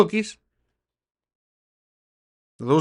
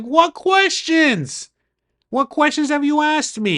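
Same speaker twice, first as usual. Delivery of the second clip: much higher, very slow and drawn out; retold incredulous protest; 12 semitones higher, slower than usual. Driver challenging the deputy's reason for arrest, incredulous. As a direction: stretch it out in disbelief.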